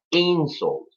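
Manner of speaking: "insult." with a high IN, falling away; stress on first syllable. Shown as a demonstration. Teacher repeats the noun, "insult." stressed on IN. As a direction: lean on IN in "insult.", ease off the second syllable. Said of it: "the accent goes on the first part".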